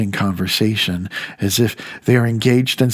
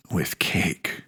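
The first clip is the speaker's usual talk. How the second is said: whispered aside; 6 semitones lower, 9 dB quieter than usual. Acted. Speaker mocking party hosts' distractions, whispered aside. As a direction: drop to a hushed, sly whisper.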